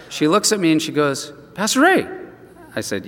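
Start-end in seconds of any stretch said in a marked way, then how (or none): 1.5-2.1 called out higher, surprised; surprised hairdresser greeting him by name. Retold or imitated. Hairdresser spotting the pastor unexpectedly, surprised call.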